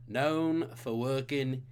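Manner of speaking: a light, slow put-on British accent; lightly voiced Cockney hint; slow, hammed-up British accent for a trivia hint. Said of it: "I'm doing a British accent".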